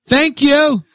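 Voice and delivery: high-pitched mimicry of an over-polite diner; imitating her blurted thanks. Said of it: "that's the way she does it".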